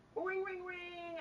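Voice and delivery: slow, high sing-song ring, ring; a playful phone ring; phone ring imitation. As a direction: mimic a phone ringing. Mimicking a phone ringing.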